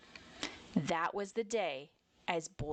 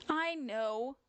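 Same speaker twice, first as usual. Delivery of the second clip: put-on higher child-bear voice, mildly sniffly; a touch of tearfulness, pitched up; 7 semitones higher than usual. A narrator's reading. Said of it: "Jazzy Bear sniffed".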